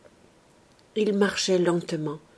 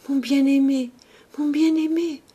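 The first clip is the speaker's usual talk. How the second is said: high, loud dying cry to a beloved; desperate; 7 semitones higher, 5 dB louder than usual. A narrator's reading. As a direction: cry out as breath runs out. Dying girl calls to her beloved.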